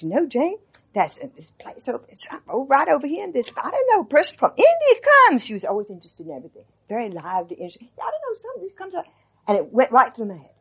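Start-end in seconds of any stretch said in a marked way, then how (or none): 0.0-5.4 lightly voiced old Southern lady, climbing higher; imitating grandmother. Telling friends how grandmother spoke, in a rising old lady voice. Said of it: "And she goes like this". 8.0-9.0 put-on high, fast old Southern lady voice; grandmother's voice. Quoting grandmother on the car ride, high and fast. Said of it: "she talks like this".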